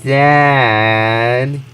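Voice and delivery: hammed-up, drawn-out scolding; drawn-out outburst. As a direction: stretch out each word in exasperation. Daughter's outburst at dad, drawn out.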